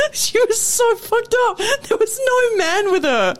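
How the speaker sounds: high, slow, laugh-squeezed voice. Real, not acted. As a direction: barely get it out through helpless laughter. Teller cracking up at own story.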